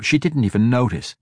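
ordinary delivery

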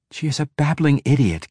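plain reading voice

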